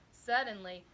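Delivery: plain reading voice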